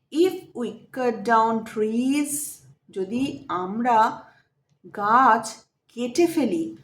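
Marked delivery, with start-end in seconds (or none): 0.1-2.6 slow reading aloud. Shown as a demonstration. Teacher reads the English lesson text.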